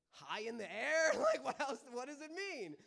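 loud, climbing into a high questioning pitch; comic exasperated bafflement; flustered guessing. Retold or imitated. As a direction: flail for an answer, voice rising. Acts himself flailing for meaning.

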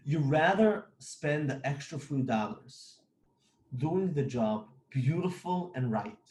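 ordinary talk, nothing marked

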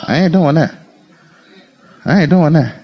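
inner refusal. Acted. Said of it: "In his heart".

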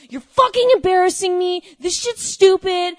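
loud, hammed-up rant at parents; imagined rant at mom and stepdad, loud and mocking; mock angry outburst. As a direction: blast it like an embarrassed teen yelling at mom. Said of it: "I would never say".

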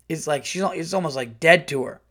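ordinary delivery

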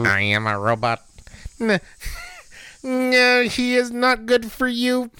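high, stiff robot voice. Acted. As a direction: speak like a movie robot. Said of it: "broken out the robotic voices".

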